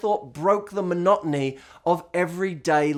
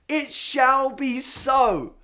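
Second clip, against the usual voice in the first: very slow, higher and louder decree; grand proclamation; 8 semitones higher, 3 dB louder, much slower than usual. Retold or imitated. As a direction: proclaim it like a king. Repeating the line grander.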